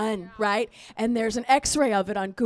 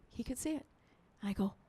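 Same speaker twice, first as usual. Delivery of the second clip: ordinary talk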